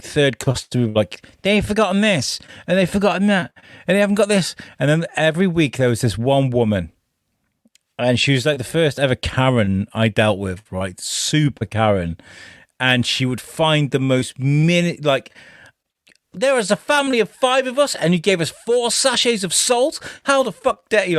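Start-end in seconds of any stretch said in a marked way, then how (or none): 1.4-4.5 mock complaining customer. Customers complaining about missing items. 16.3-21.2 high, put-on Karen voice, mock indignant; hammed-up entitled customer whining about the salt; outraged Karen. Karen customer complaining every week.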